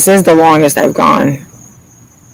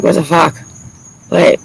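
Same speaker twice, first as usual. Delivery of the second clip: ordinary delivery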